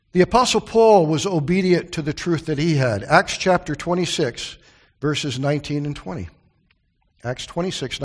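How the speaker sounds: ordinary talk, nothing marked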